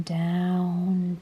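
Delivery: plain reading voice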